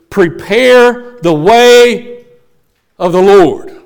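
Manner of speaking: slow, ringing proclamation; proclaiming. Acted. John the Baptist announcing the Lord, slow loud proclamation. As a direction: call it out slowly like a herald. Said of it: "listen to this".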